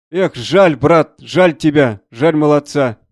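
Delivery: loud, drawn-out lament; heartfelt regret. Acted. Colonel to a soldier who lost his leg, lamenting.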